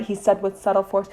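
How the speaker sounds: plain reading voice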